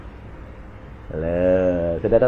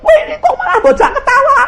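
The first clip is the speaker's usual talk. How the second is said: high, fast, laughing shout; laughing mock scold; 6 dB louder, faster than usual. The mother scolding the pastor for laughing, laughing high. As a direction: scold him while laughing yourself.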